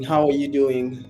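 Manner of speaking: usual speaking voice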